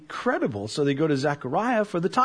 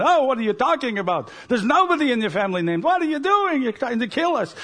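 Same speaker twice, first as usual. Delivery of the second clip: high, loud mock outrage, half laughing; hammed-up family uproar; 6 semitones higher, 3 dB louder than usual. Preacher voices relatives shrieking at Elizabeth, hammed up and laughing. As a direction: play the shrieking relatives over the top. Screaming family in Neil Simon style.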